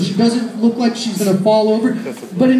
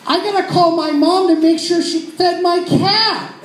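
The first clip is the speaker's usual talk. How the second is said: high nerdy whine; 9 semitones higher than usual. Retold or imitated. Nerdy truthful answer voiced.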